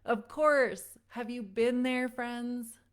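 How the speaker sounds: normal talking voice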